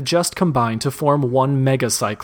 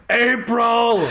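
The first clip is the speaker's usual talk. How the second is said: shout; 10 semitones higher than usual. Acted. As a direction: call out her name in horror. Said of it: "Finn shouts".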